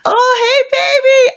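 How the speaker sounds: very high squealed hello; excited greeting. Real, not acted. Greeting friend arriving on call.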